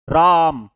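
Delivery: राम said loud and clear, each sound distinct; spelled-out word. Shown as a demonstration. Teacher to pupils, sounding out राम loudly. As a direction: enunciate राम for the class. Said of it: "और ये बोला जा रहा राम".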